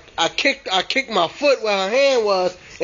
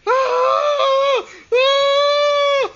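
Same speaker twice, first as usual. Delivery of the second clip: screaming in fright; much slower than usual. Retold or imitated. Boy fleeing from Bloody Mary, screaming in terror. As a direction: shriek her name as you flee. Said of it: "And I ran".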